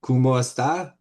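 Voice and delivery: "¿Cómo está?" said in a light Spanish accent; Spanish greeting. Shown as a demonstration. Reading to the group, quotes "¿Cómo está?" in Spanish. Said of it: "In Spanish".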